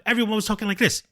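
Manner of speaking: imitating fast cartoon talk; Speed Racer character. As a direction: rush it out like a dubbed cartoon. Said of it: "talking like a speed racer character".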